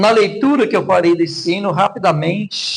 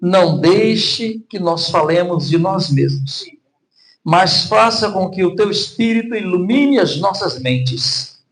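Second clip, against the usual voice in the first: prayer; 3 semitones lower, 4 dB louder than usual. Acted. Opening prayer before sermon.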